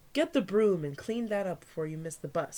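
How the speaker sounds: ordinary delivery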